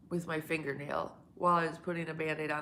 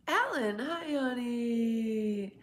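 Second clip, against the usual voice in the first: sweet greeting; 7 semitones higher, 4 dB louder, much slower than usual. Real, not acted.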